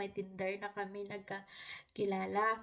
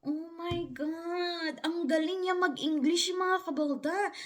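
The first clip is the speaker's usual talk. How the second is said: slow, higher, stretched oh my God; awed oh my God; 6 semitones higher, 4 dB louder, slower than usual. Real, not acted. Hearing his voice for the first time, awed and slow. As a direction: let the awe stretch it out. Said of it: "pag narinig ko yung boses niya".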